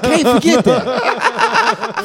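slow, high and laughing; drawn-out laughing disbelief. Retold or imitated. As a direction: stretch it out while laughing. Reacting to SpongeBob on TV.

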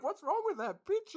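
very high, amused mock bafflement; incredulous self-quote. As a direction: squeak out the baffled reaction, tickled. Repeats the baffled reaction, laughing.

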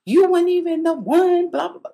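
high, mock crying-mad rant; upset outburst. Showing how she rants when mad, high mock upset. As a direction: act out getting worked up, pitch way up. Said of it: "I really get upset".

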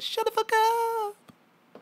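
high, drawn-out yelling rapper. Retold or imitated. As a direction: bellow it like a rapper telling them off. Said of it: "Juicy J ... and he says".

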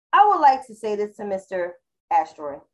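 ordinary delivery

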